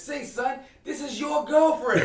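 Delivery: high, loud, drawn-out callout; mock confrontational call, stretched out; acted confrontation. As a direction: retell it as a high, slow challenge. Acted street confrontation being retold.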